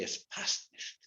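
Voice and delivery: hushed Yiddish reproof; whispered Yiddish. Shown as a demonstration. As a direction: whisper "Es passt nicht." gently. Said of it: "in Yiddish they say".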